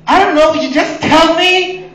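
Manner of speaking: high, loud, slow whine; high, loud, slow whining of the fiance who won't think; whiny fiance. Retold or imitated. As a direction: play the fiance giving up, whining. Fiance voiced by teller.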